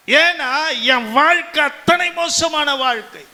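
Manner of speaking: fervent pulpit confession; impassioned testimony. Acted. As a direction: testify with mounting fervour, phrase after phrase. Preacher testifies about worthless former life.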